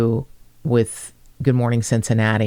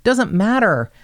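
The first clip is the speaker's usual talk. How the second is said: ordinary delivery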